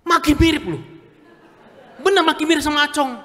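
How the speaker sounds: high, loud, fast punchline; preacher hams up the punchline to the crowd; comic outburst. Acted. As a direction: blurt it out for laughs.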